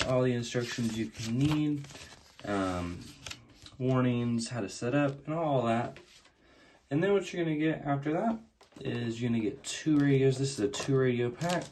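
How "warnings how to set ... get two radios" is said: ordinary delivery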